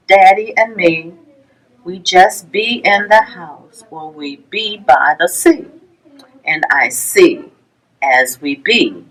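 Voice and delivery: ordinary delivery, nothing marked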